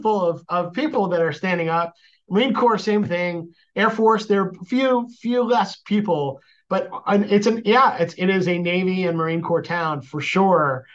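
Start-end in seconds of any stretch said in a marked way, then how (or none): none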